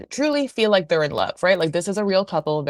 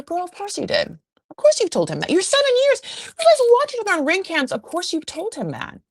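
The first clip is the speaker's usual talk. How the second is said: exasperated; 12 semitones higher, 3 dB louder than usual. Real, not acted. Exasperated rant at Sheena.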